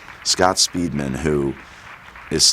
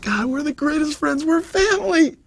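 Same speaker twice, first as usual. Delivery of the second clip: mock-gushing, much higher, over-sweet goodbye; fake gushing. Mocking cast goodbyes on any movie, high gushing. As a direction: gush like a fake-sincere castmate.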